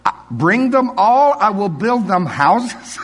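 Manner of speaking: imitating Afrikaans accent. Afrikaans host offering houses for the grandchildren, Afrikaans accent. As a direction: brush the excuse aside in an Afrikaans accent. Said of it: "I'm gonna use his accent now".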